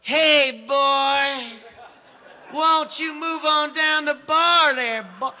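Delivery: high, loud, slow caricature bigot. Retold or imitated. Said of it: "I think I'm supposed to go".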